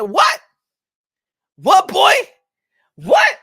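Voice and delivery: rising mock outrage, what boy; indignant what. Acting Charlo's indignant reply.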